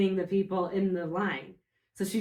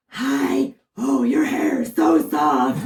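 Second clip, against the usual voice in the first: higher, louder, slow and drawn out; imitating her voice; 5 semitones higher, 9 dB louder, much slower than usual. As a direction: coo slowly like the masked girl. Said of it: "She was like".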